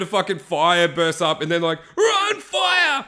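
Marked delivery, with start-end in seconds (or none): 2.0-3.0 high, hammed-up yell of alarm; shrill-pitched mock panic yell; panicked warning shout. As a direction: shout a frantic warning to the gang. Acts riders fleeing burst of fire.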